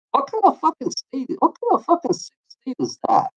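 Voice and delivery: high incredulous outrage. Real, not acted. Outraged at Texas law.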